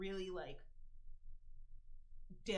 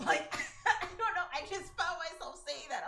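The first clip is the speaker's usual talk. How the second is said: laughing; 8 semitones higher, 8 dB louder than usual. Real, not acted. Laughing mid-rant.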